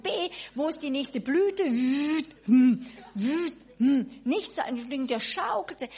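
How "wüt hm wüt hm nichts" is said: mock flutter sounds. Imitating butterfly fluttering sounds.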